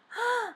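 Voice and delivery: sharp high gasp; a startled intake of breath; surprised gasp. A narrator's reading. Bears find the open door.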